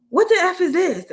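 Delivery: high loud mock yell of an angry customer. Customer shoves the fry box at the teen cashier, mock yell. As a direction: belt it out like a furious customer.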